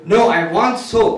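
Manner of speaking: loud, slow angry demand; getting angry. Acted. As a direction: insist like an irate customer. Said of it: "the guy's getting angry".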